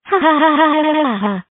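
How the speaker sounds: quick, mechanical string of ha-has; mock scheming laugh. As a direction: fake a fast, staccato laugh. Prankster laughing at his plan.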